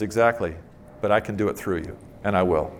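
lightly voiced God, low and measured; understated low, assured reply; God's reply. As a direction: answer softly and low as God. Voicing God's answer to the prayer.

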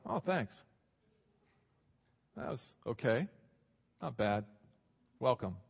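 a half-hearted mock welcome; imagining heaven's bored greeting, mildly unimpressed.

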